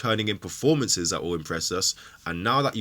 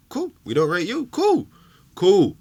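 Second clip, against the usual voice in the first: high, loud mocking cool; mocking dismissal; 9 semitones higher, 6 dB louder than usual. Real, not acted. Fan taunting Xhaka, loud mocking dismissal. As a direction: toss off a scornful cool.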